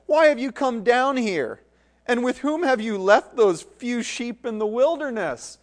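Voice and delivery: high, loud scolding; angry older brother. Acted. Eldest brother rebukes David, loud and scornful. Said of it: "you can hear his tone".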